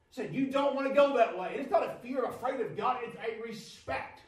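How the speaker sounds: rising preaching. Acted. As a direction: drive the testimony home.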